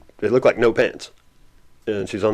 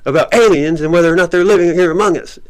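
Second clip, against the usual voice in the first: louder, much higher sharp retort; snapping; 8 semitones higher, 5 dB louder than usual. Retold or imitated. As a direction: put the rude stranger in her place. Friend snaps at the stranger.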